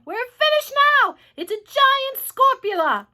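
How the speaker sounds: high, loud wail of doom; panicked yell. A narrator's reading. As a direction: shout it in terror, stretching the last words. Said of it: "yelled the head of the fire department".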